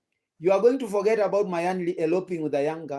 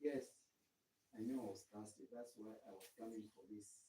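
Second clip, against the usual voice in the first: far off mic; 6 semitones lower, 19 dB quieter than usual. Real, not acted. Away from mic, drinking.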